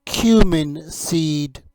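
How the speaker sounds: slow, careful "cumin seed." for the class to copy; slow teaching model; slow repeat prompt. Shown as a demonstration. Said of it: "You say it".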